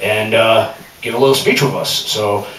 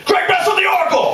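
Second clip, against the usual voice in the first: hyped announcer; faster than usual. Shown as a demonstration. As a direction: hype up the crowd. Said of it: "Let's try this again".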